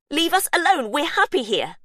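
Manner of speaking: high, loud, desperate shout; desperate yell. Acted. Faith yells at the intruders breaking in. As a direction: scream at them to leave.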